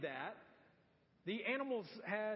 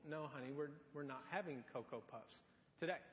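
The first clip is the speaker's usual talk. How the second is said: much lower and softer, patient parent; calm mom; 7 semitones lower, 8 dB quieter, faster than usual. Retold or imitated. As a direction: gently soothe the child. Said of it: "the mom is very calm and says".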